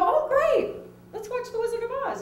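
high, eager cheer on "Oh, great"; excited group reply. Retold or imitated. Friends at brunch reacting eagerly to the movie news. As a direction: pitch it way up like a party about to start.

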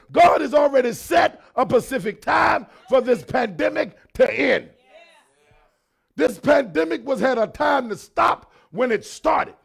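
loud, rising preacher's cadence; full-force preaching. Acted. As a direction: drive each phrase home to the congregation.

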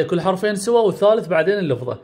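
normal talking voice

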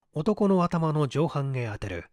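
usual speaking voice

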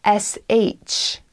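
ordinary delivery

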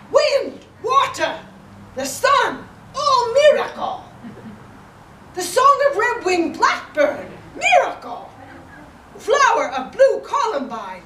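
awakened poet's voice, loud, high-pitched exclamations; Nanao. Acted. Imitating a poet friend to start a poetry class for fifth graders, awakened voice. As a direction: cry out each phrase in a wildly high, loud imitation. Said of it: "gonna try to read it in his voice".

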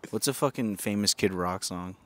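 normal talking voice

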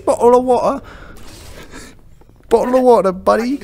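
high-pitched, hammed-up British accent; exaggerated cartoon British accent; mock British accent. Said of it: "I'm bloody British".

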